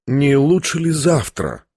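ordinary delivery, nothing marked

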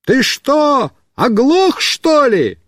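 high exasperated yell; shouting. Acted. Boy on the car yells at deaf friend, irritated shouting. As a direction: shout it out, annoyed. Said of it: "Я кричу".

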